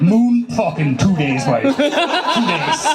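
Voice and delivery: put-on slow, cocky drunk boast rising high; drunk Irish bluster. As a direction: boast like a pub know-it-all. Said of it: "but like".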